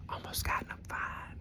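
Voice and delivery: whispered, like spilling gossip; hushed storytelling whisper; gossipy whisper. Real, not acted. Hushed gossip about a fight.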